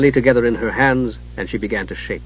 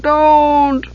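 high, desperate howl; howling warning; 14 semitones higher than usual. Acted. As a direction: howl at her not to look. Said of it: "Dugan howled".

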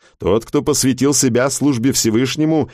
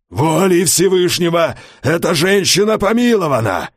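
hoarse shout; 8 semitones higher than usual. A narrator's reading. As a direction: cry it out hoarsely to the crowd. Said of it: "хрипло выкрикнул он".

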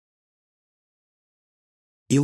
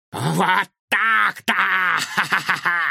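slow, high, cackling triumphant laughter; villainous guffaw; 6 semitones higher, 3 dB louder, slower than usual. Acted. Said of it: "хохотал доктор Финдершлотц".

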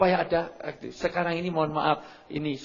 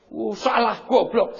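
mock scolding; 8 semitones higher, 5 dB louder, slower than usual. Scolding parent acted out.

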